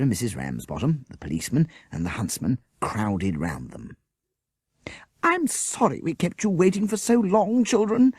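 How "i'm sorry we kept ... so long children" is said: breathless. Acted. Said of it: "Miss Beaver said breathlessly".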